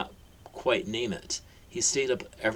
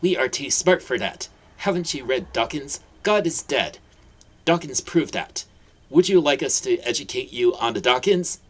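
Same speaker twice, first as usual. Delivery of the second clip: higher, louder condescending aunt; smug lecture; 5 semitones higher, 7 dB louder than usual. Acted. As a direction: talk down to the simpleton. Petunia lectures smugly.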